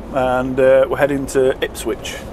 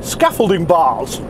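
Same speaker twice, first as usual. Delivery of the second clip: much higher, hammed-up posh English gent; 7 semitones higher than usual. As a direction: put on a silly upper-class voice for a laugh. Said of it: "I was gonna do my posh voice".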